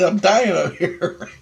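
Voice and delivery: laughing. Real, not acted. Laughing while retelling choking.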